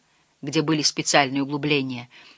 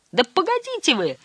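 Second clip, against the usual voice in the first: high-pitched exasperated call; crying out; 12 semitones higher than usual. A narrator's reading. Uncle calling from overboard to stop the count, high cry. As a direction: call out high to halt them. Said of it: "крикнул дядя Солтерс из-за борта".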